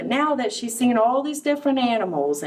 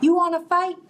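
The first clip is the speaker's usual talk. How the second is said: high, pushy little bug picking a fight; grouchy ladybug; 7 semitones higher than usual. Acted. Grouchy ladybug daring the hyena to fight, high and cocky. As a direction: challenge them, chin up and cocky. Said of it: "said the ladybug".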